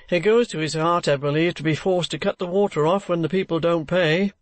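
a lightly voiced joking friend. A narrator's reading. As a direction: slip in a sly joke. Bachelor friend makes a joke.

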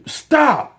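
sudden high, loud stop; shouted stop. Real, not acted. Scolding his viewers, a sudden loud stop. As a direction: cut them off hard with one loud word. Angry rant about people feuding.

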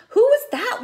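ordinary delivery, nothing marked

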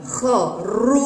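"خروف" as kha, then long rūū; strong damma. Shown as a demonstration. Teacher asks if children hear it, vowel drawn out. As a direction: stretch the second syllable of خروف. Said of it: "وينطق ضمة شديدة".

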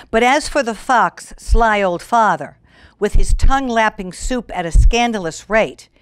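lilting rhyming storybook read; story narration. A narrator's reading. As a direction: read the verse to children. Reads rhyming fable aloud.

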